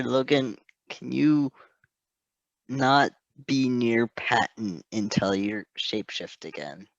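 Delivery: plain reading voice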